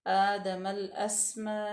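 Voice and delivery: आदमल अस्मा with the long vowel held four beats; slow, drawn-out recitation; elongated madd. Shown as a demonstration. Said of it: "चार अलिफ के बराबर लंबा करेंगे".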